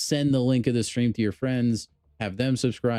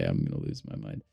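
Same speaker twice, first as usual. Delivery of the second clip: whispered aside; 7 dB quieter than usual. Real, not acted. Whispered aside after laughing.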